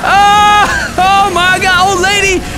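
high, loud cry of shock; loud, high exclamation; shocked yell. Real, not acted. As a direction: cry out in alarm. Shocked cry during game rampage.

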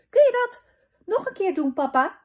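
put-on high, loud little-girl excitement; eager child begging. A narrator's reading. Little Pip asking papa for an encore, eager begging. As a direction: plead excitedly at the top of your voice.

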